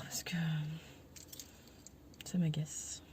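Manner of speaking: annoyed whisper under breath. Real, not acted. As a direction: whisper it out, irritated. Trying to calm down, annoyed.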